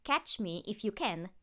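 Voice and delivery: loud, teasing sing-song; mocking. A narrator's reading. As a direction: taunt her as you run off. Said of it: "he mocked".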